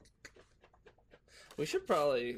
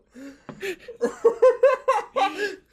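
high, loud burst of laughter; loud shrieking laugh; 16 dB louder than usual. Real, not acted. Big laugh.

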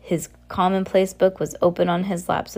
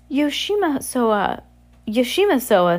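put-on baby voice; 6 semitones higher, 3 dB louder, slower than usual. Baby Sunny's emphatic refusal.

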